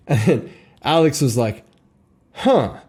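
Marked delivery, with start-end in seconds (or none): 2.3-2.8 a short high, baffled "huh"; mock dumbfounded grunt; stunned huh. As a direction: let it land as a sudden realisation. Alex's stunned reaction.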